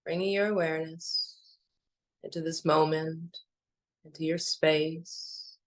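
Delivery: calm, unhurried meditation voice with long pauses; even, spacious delivery, letting each phrase settle; guided meditation. Acted. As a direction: lead listeners gently inward, phrase by phrase.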